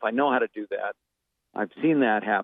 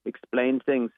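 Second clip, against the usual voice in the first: put-on German accent; measured German-accented English. Shown as a demonstration. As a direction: slip into a German accent mid-explanation. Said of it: "Germany, wherever you happen to go".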